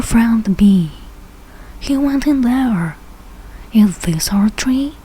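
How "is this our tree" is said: ordinary delivery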